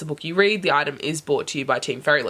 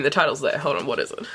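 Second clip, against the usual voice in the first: normal talking voice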